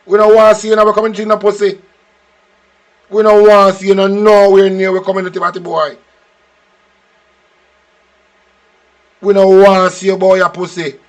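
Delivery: angry shouting. Real, not acted. Shouting at Andrew to stay out of their community, enraged. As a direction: yell it at him with venom.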